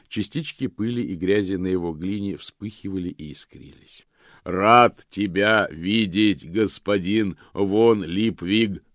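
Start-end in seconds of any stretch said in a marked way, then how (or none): 4.4-8.8 slow, loud booming voice; booming golem. A narrator's reading. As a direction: boom it out joyfully. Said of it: "радостно прогремел он". (радостный громовой голос голема)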